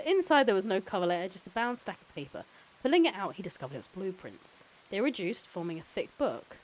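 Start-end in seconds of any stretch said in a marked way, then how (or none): none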